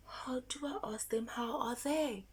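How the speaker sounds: hushed teasing whisper. Shown as a demonstration. Teacher whispering a puzzle to the children. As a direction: lean in and whisper the question.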